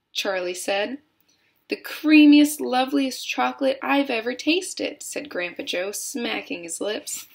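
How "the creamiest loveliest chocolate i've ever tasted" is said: high, emphatic opening on the praise; relishing delight. A narrator's reading. Grandpa Joe praises the chocolate he tasted, relishing it. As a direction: savor every word of praise.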